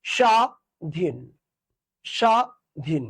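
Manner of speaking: স্বাধীন said very slowly, স্ব pressed hard; stress on স্ব. Shown as a demonstration. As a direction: stretch out স্বাধীন, hitting স্বা. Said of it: "তাহলে এখানে জোর দিতে হবে, স্বটা একটু জোর দিয়ে পড়তে হবে". (স্বাধীন শব্দে স্ব-তে জোর দিয়ে, খুব ধীরে)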